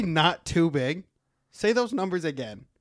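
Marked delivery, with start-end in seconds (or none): 0.0-1.0 incredulous echo. Retold or imitated. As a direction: quote it back slowly in disbelief. Incredulously quoting co-host back.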